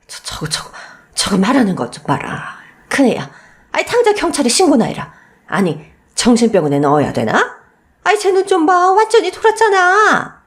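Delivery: breathless stammer rising to a high indignant cry; scandalized old woman calling her crazy; mother-in-law shocked and indignant. Acted. Mother-in-law reacts to the outburst.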